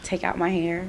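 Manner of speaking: ordinary talk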